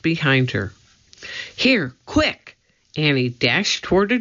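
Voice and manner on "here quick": high, hurried-sounding call; urgent. A narrator's reading. Annie steering Jack toward a door, urgent. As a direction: urge him in at once.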